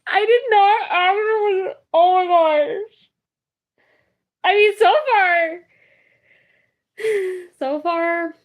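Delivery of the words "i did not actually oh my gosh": laughing disbelief. Real, not acted.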